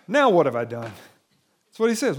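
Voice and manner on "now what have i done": weary. Retold or imitated. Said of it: "He's exhausted".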